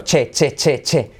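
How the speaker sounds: "che" tapped out evenly, crisp ch stop; machine-like run of "che"; repeated CH drill. Shown as a demonstration. Teacher drilling learners on ch, repeated crisp syllable. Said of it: "Repetid conmigo".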